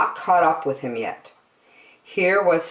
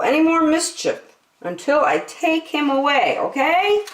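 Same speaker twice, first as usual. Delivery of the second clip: higher, earnest pleading voice; man on the phone; 12 semitones higher than usual. A narrator's reading. Man with the yellow hat pleading on the phone, earnest. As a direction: beg the nurse to mind him. Man phoning the hospital nurse.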